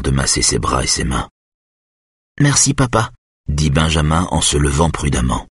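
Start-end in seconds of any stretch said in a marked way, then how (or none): 2.4-3.1 put-on high little boy's voice; clear, high voice of a young son; clear, high-pitched child. A narrator's reading. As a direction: pitch it up like a small child piping up. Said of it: "sa voix claire et haut perché".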